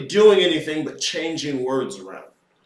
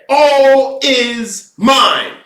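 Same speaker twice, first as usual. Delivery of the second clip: "All is mind" drawn out very slowly, higher and louder; declaring loudly; 6 semitones higher, 6 dB louder, much slower than usual. Shown as a demonstration. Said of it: "If I just said".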